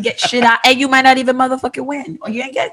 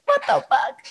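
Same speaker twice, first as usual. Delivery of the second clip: high laughing what the fuck; laughing disbelief; 4 dB quieter than usual. Real, not acted. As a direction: squeal it through a laugh.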